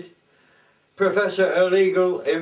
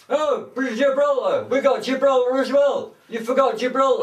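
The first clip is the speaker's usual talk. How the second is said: excited outburst; 7 semitones higher, 3 dB louder than usual. Acted. Suddenly remembers Gibraltar.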